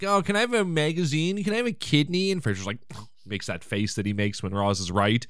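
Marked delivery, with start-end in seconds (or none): 0.0-2.3 higher-pitched imitation of whiny, needy people; put-on pleading whine; mock whining. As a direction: whine the requests in mockery. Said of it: "they're so whiny. They're just like".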